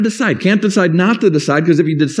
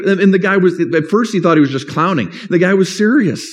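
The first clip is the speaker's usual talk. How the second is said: ordinary talk, nothing marked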